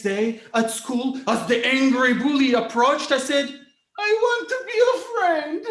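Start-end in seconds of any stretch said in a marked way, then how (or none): 4.0-5.7 put-on high, eager little boy; sweet kid voice. Young boy to bully, high eager offer. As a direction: offer friendship brightly.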